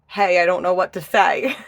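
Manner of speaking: loud, high, cheery; mock viewer email. Reader acting out a viewer's hello, loud and cheery. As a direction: play a shy viewer blurting it out. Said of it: "just say".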